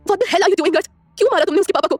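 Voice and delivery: high, loud furious yell; shouting. A narrator's reading. Heroine screams at her lover for the killing, loud furious yell. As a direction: yell at him, pitch way up. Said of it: "अर्थ पर चिल्लाते हुए कहती है".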